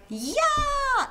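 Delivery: high joyful "Yeah!" yelled out; gleeful kid cheer. Pretend. Kid freed for summer, high cheering yell. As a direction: cheer like school just ended. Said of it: "kid who's just been let out".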